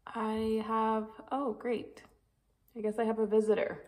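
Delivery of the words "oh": ordinary delivery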